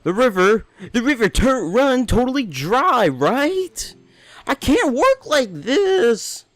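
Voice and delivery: high, slow, whiny lady; high Zora lady voice. Acted. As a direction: pitch way up and whine like a fussy fish lady. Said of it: "I don't have a voice for her".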